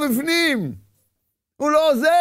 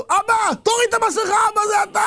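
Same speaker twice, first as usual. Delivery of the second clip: shouting to father; 9 semitones higher than usual. Acted. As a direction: call out to the father behind the mask. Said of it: "ואתה צועק לו".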